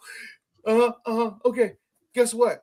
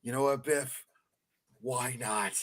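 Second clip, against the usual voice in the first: mock McFly reply; 6 semitones lower than usual. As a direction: answer like a meek pushover. Acting McFly's timid answer.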